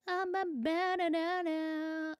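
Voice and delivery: slow, high silly voice. Pretend. Streamer reading a chat message in a silly voice. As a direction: read it like a goofy cartoon.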